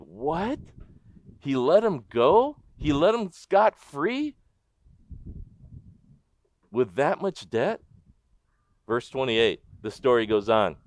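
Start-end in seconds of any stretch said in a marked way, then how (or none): 0.0-7.8 mock disbelief. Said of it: "you stop and you think".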